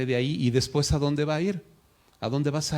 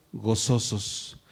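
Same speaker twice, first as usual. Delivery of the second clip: gozosos stretched very slow and low, every syllable held; drawn-out emphasis; 3 semitones lower, 4 dB quieter, much slower than usual. Shown as a demonstration. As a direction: savour the word gozosos, slowly. Preacher repeats the key word. (gozosos alargado y lento, con énfasis)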